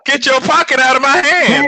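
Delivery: very high, loud hammed-up yell; mock outcry. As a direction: yell it high, parodying a film line. Hammed-up twist on quoted movie line.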